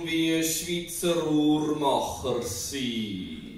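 very slow, drawn-out, sustained syllables; measured, ultra-slow delivery with long held vowels; deliberate and slow. Acted. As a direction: stretch each word out with great care. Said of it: "und langsam".

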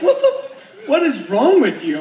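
high, loud appalled shriek through laughter; appalled friend. Retold or imitated. Friend reacting to the joke, a high laughing shriek. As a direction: shriek it, half laughing, disgusted. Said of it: "And they're like".

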